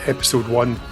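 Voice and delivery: usual speaking voice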